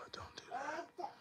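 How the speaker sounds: sharp whispered don't do that; hushed warning. Real, not acted. Telling his kid off the gear, whispered.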